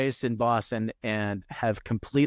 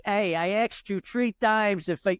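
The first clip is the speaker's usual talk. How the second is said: mock thick Brooklyn accent, a little higher; 5 semitones higher than usual. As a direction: imitate a crazy Brooklyn accent. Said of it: "crazy accent".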